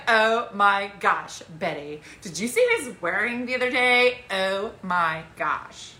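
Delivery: put-on drawn-out Valley girl lament; slow, gossipy Valley girl. Shown as a demonstration. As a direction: stretch out the oh-my-gosh like a Valley girl. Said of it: "Valley Girl's like".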